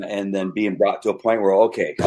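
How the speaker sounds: ordinary talk, nothing marked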